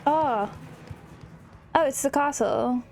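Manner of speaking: usual speaking voice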